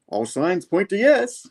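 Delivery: high, slow mock-prophetic answer; oracle henchman. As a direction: intone it like a toy fortune-teller. Eight ball character gives answer.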